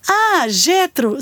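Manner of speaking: usual speaking voice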